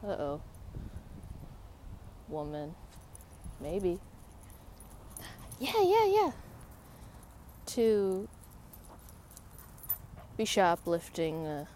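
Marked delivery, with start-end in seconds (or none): 5.6-6.3 high, put-on yeah yeah yeah; silly high aside. Real, not acted. Vlogger shrugging off an idea, silly high voice. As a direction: toss it off in a silly voice.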